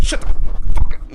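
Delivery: very loud, drawn-out harsh yell; mock furious outburst. Boss is on you at work, mock furious yell. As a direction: explode at the nagging, stretching each word. Acts snapping at the nagging boss.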